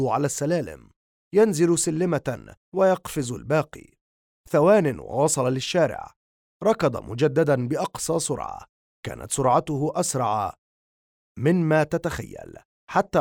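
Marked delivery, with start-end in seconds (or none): none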